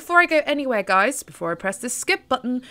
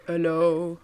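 deep bass hello; 6 semitones lower than usual. Shown as a demonstration. Showing viewers her bass morning voice, low hello. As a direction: drop the voice lower for a bass hello. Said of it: "like a really bass voice today".

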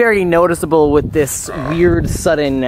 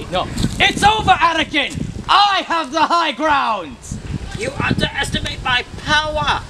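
dramatic shout; 10 semitones higher than usual. Acted. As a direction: declaim it like the Star Wars duel. Acting Obi-Wan and Anakin.

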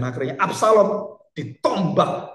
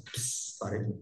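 secretive whisper; 6 semitones lower, 12 dB quieter, much slower than usual. Retold or imitated. Investors quietly pulling money out.

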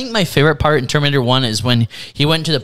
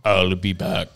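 low, softer put-on movie-cyborg voice; Terminator impression; 8 semitones lower, 4 dB quieter, much slower than usual. Terminator turning to the cop, low imitated voice. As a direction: drop the pitch right down like the Terminator. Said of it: "he turns to the cop and he says".

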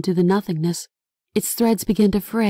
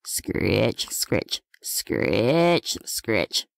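low, rasping scritch noises; scratching sound; 10 semitones lower, 5 dB quieter, much slower than usual. A narrator's reading. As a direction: scrape it out low and slow, like claws.

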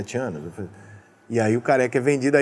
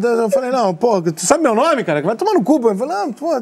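higher, keyed-up stammering fan; flustered excitement; 7 semitones higher than usual. Retold or imitated. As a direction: blurt it out high and starstruck. Starstruck fan flustered by idol.

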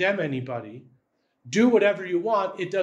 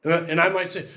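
ordinary talk, nothing marked

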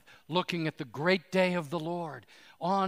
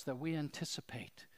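hushed whisper in prayer; 8 dB quieter than usual. Acted. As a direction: breathe it out as a whisper. Hushed line inside the prayer.

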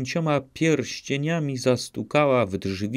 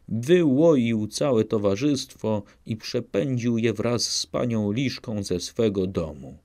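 ordinary delivery, nothing marked

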